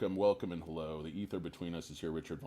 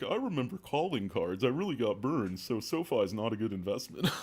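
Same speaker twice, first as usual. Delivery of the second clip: higher, louder put-on old-timer voice; old bear impression; 6 semitones higher, 5 dB louder than usual. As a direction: play a fearful boomer investor.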